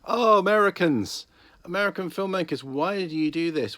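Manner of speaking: loud, high-pitched plea of exasperation; exasperated lament. Real, not acted. Pleading with American filmmakers over poor accents, loudly exasperated. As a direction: wail it in despair at them.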